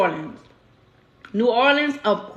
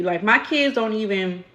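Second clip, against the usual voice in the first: ordinary talk, nothing marked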